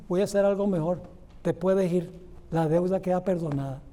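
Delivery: merciful pardon. Acted. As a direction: grant the pardon gently and kindly. Señor, movido a misericordia, perdona. (perdón compasivo, más grave y suave)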